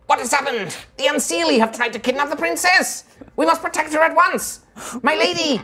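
squeaky-high, alarmed little fairy; high-pitched tiny warrior. Acted. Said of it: "kind of high-pitched voice".

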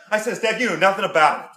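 put-on thick Pittsburgh yinzer accent, dropped grammar, "jeez" fillers. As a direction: play an over-eager Pittsburgh superfan. Comic Pittsburgh superfan character.

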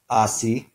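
"asi" with stress on the first syllable, AS-i; Finnish stress. Shown as a demonstration. Teacher showing Finnish first-syllable stress on "asi". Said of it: "each of those first syllables is stressed".

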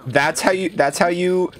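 high-pitched, stammering show of respect; amused, flustered concession. Real, not acted. Flustered respect after laughing fit.

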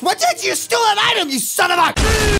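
loud high outraged yell; shouting. Acted. Yelling at teammate over chest.

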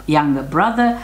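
usual speaking voice

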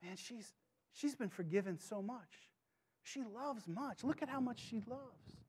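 almost under the breath, full of love; gentle Jesus. Retold or imitated. Jesus answering the Pharisees, hushed and tender.

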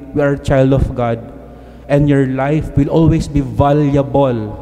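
ordinary delivery

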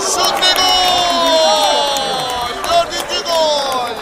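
slow stretched goal roar; screaming goal call. Real, not acted. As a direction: hold the goal cry long and loud. Fourth goal, live call.

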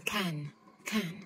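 British English can with an open round a. Shown as a demonstration. Teacher showing learners the British can. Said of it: "Người Anh thì họ sẽ đọc là".

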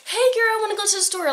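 put-on chirpy girl chat. Girl casually asking a friend along to the store, high mock-girly voice.

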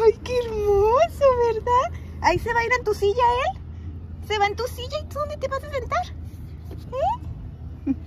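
mother asking her daughter about the new puppy, high and sing-song. Real, not acted. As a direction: gush at the little one with a high lilting voice. Cooing over puppy to toddler.

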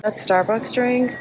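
ordinary talk

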